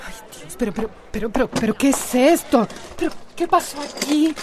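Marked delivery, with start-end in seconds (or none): 0.1-0.6 a whispered, voiceless gasp of dismay; breathless gasp of shock. Acted. Amanda seeing her robbed salon, whispered gasp.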